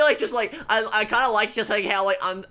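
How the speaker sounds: usual speaking voice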